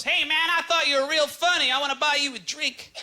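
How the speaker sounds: high-pitched, eager guy after the show; enthusiastic small-town fan. Retold or imitated. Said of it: "this guy comes up, and he goes".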